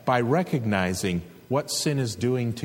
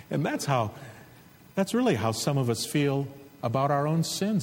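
ordinary talk

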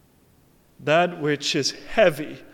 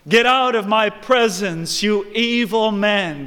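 raised, commanding rebuke; stern condemnation; 5 semitones higher, 6 dB louder than usual. Acted. God dismissing evil men from his presence, stern condemnation. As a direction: cast them out with authority. Voicing God's rejection of sinners.

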